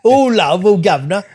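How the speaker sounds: high, hammed-up Cockney accent; mock Cockney. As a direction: ham up a broad Cockney like on EastEnders. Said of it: "that awful Cockney accent".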